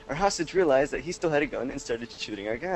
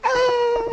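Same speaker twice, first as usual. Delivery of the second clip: high, louder hammed-up scream; mock train scream; 5 dB louder than usual. Imitating screaming train passengers, high mock scream. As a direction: squeal like a tiny game sound effect. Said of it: "people on the train screaming".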